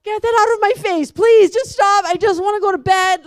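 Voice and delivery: snapping at someone filming after an exhausting day; exasperated outburst. Retold or imitated. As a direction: shove the camera away with your voice. Pushes camera away, exhausted.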